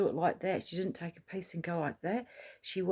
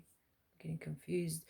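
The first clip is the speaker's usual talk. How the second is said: whispering; 9 dB quieter than usual. Real, not acted. Whispered aside.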